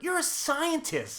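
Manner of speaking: high, drawn-out exasperated scolding; exasperated rant. Retold or imitated. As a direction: scold him like you can't believe it. Ranting at movie scientist.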